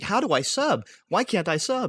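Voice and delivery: high, loud clueless viewer; confused chatter voice. Retold or imitated. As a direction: squeal it like a lost fan. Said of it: "everyone's like".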